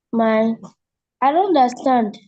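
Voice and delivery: ordinary talk, nothing marked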